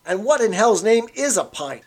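put-on a barman brushing off an old man; barman, a touch of scorn. A narrator's reading. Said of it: "said the barman, leaning forward".

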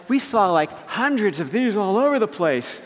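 put-on eager teen chatter; imitated teenage daughter. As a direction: mimic a girl bragging with glee. Daughters boast about spiders.

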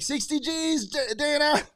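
gleeful mock Connor voice; imitating Connor. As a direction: pitch it way up like a giddy kid. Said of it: "He's like".

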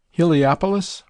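mumbled through a mouthful of stew; talking while chewing; mouth full. A narrator's reading. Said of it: "mumbled Nelson, his mouth full".

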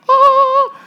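very high, loud "oh" of wonder; hammed-up awe. Philip announcing Jesus to Nathanael, exaggerated awe. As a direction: blow it up into a huge high gasp of amazement.

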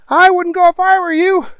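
loud, squeaky-high warning; high henchman voice. A narrator's reading. Mike warning Marco not to go out, high henchman voice. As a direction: warn him in a very high comic voice.

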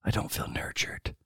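soft unvoiced whisper. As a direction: whisper it like a secret confession. Mock massage client complaint.

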